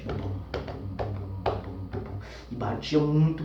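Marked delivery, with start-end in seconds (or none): 0.1-2.2 soft, low thumping bum bum bum; quiet low heartbeat sounds; heartbeat imitation. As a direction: mimic a pounding heart, under the breath. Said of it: "escutava meu coração batendo forte".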